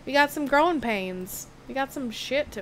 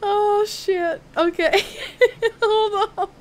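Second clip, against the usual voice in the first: higher and louder, a startled yelp into laughter; 8 semitones higher, 5 dB louder, slower than usual. Real, not acted. Streamer startled by something in the game, yelping with laughter. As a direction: caught off guard, laughing it off.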